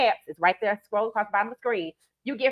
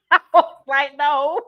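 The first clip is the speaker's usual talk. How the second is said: laughing; 4 semitones higher, 7 dB louder than usual. Real, not acted. Retelling being asked if okay.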